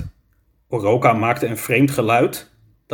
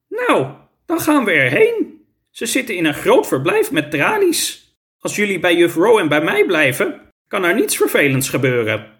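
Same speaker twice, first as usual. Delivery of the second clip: teacher leading and reassuring; 6 semitones higher than usual. A narrator's reading. Teacher takes children to see tigers, high voice easing down. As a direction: lead the children off, then reassure them.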